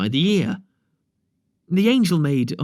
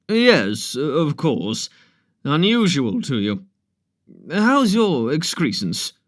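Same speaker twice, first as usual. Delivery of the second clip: brisk doctor; slower than usual. Acted. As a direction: bluff and businesslike with a patient. Doctor Crump greeting the angel.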